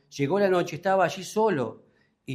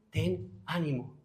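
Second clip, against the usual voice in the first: "Ten ánimo" much softer and slow, like a gentle comfort; tender reassurance; 9 dB quieter, slower than usual. Acted. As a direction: say "Ten ánimo" softly to someone hurting. Lord speaking to the struggling listener.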